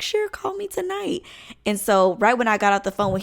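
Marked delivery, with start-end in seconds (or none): 0.0-1.2 put-on boyfriend voice.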